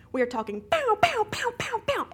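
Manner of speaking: loud rising pow pow; cartoon explosion noises; mock crash sounds. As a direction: blast out each pow like an explosion. Computer crash sound effects.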